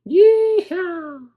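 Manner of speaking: put-on plotting dino plays cowboy, southern accent. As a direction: say it like a gleeful Texan outlaw. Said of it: "in a southern accent".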